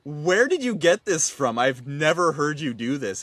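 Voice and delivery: loud, high, drawn-out exasperated questioning; hammed-up bewildered parent; baffled parent. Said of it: "and I'm just like".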